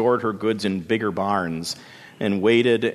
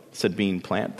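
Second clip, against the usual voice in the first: usual speaking voice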